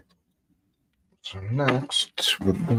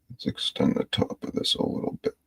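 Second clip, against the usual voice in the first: lower, soft unvoiced whisper; 8 semitones lower, 6 dB quieter, faster than usual. Real, not acted. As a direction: murmur it to yourself. Murmured to self while painting.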